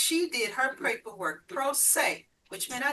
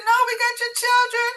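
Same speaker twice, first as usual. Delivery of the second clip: high, loud insistence of joy; excited news; 14 semitones higher, 11 dB louder than usual. Retold or imitated. Detective repeating the good news to the mother, thrilled. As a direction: say it again, beaming and loud.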